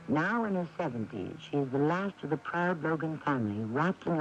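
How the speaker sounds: even, measured radio narrator introducing the scene; story narration. A narrator's reading. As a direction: tell it like an old radio drama announcer. Radio drama narrator sets scene.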